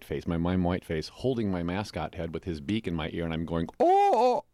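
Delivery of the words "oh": high, loud comic "oh!"; hammed-up yelp for a photo pose; mock surprised "oh". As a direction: belt out a big cartoon "oh!". Acts out magazine cover pose.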